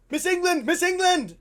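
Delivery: loud, high mock yell for the teacher; high-pitched shouted call. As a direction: yell like an alarmed schoolboy. Boy calls out to teacher.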